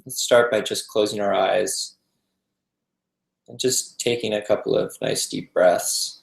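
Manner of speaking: calm, unhurried meditation guide with long pauses; guided meditation. Acted. Teacher guiding a group to relax into the breath, calm meditation voice. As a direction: ease them gently into stillness, leaving space between phrases.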